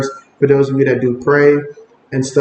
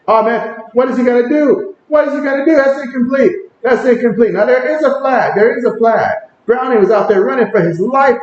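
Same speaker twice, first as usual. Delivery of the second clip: higher, rapid live reaction to the play; excited play reaction; 7 semitones higher than usual. Real, not acted. Streamer calling the game to chat, excited. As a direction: react like a fan at a big moment.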